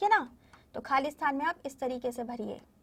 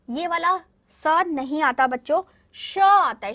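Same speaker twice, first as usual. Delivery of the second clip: emphatic correction; 2 semitones higher, 7 dB louder than usual. Shown as a demonstration. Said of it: "emphatic teaching correction".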